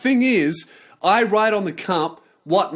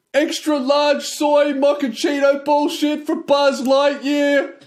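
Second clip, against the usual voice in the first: barista yelling an order; 9 semitones higher, slower than usual. Retold or imitated. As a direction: yell it across the cafe like a fed-up barista. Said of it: "now I have to yell out Buzz Lightyear".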